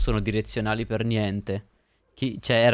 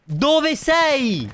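sudden high shout at enemy; loud yell; 14 semitones higher, 5 dB louder than usual. Real, not acted. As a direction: yell it out in surprise. Gamer yelling at enemy.

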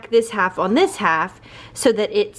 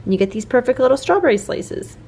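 ordinary talk, nothing marked

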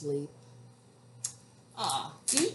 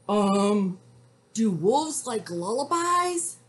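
hesitant, loud question climbing higher; nervous cowboy; 3 semitones higher, 6 dB louder than usual. A narrator's reading. Cowboy asks wolf about lullabies, nervous raised voice. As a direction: ask the wolf uneasily.

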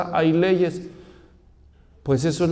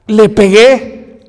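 put-on slow, loud, proud child's confession; proud little boy; 2 semitones higher, 9 dB louder, much slower than usual. Niño anunciando orgulloso a todos que le pegó. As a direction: announce it to the whole church like a trophy.

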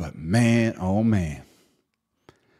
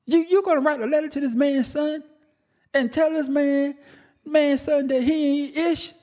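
outraged, voice jumping much higher; voice gone high; 7 semitones higher than usual. Real, not acted. Reacting to [name]'s letter to [name]'s son, voice cracking high. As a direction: let the disbelief push your voice way up. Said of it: "my voice done went and got high".

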